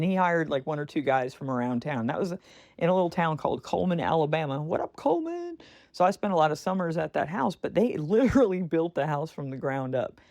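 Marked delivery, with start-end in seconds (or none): 4.6-5.6 playful shout-out. Real, not acted. Shout-out to hometown.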